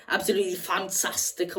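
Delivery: a slight Scouse slip on absolutely fantastic; slipped into Scouse. Real, not acted. Fan on his vlog slips into a hint of Scouse. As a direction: Liverpool lilt creeping in on absolutely fantastic. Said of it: "I went a bit scouse there".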